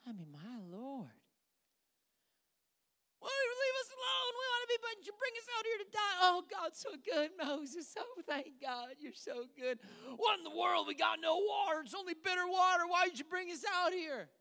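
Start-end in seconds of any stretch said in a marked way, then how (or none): 3.2-6.2 high, fast whining crowd voice; whiny complaining Israelites. Acted. Preacher mocks Israelites whining at Moses, high whine. 6.2-9.8 lightly hammed-up high praise; mock gushing praise. Preacher mimics fickle people praising Moses, high and gushing. 10.2-14.3 mock complaining shout. Preacher voices Israelites grumbling about bitter water, high shout.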